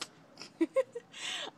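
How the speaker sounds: high, loud delighted laugh; mother laughing at the chaos; high happy laugh. Real, not acted. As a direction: laugh it off with joy. Laughing at twin birth.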